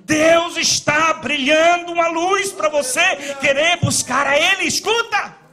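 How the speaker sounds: loud, climbing pulpit preaching; fervent sermon shout; preaching at full force. Acted. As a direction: preach it out to the congregation.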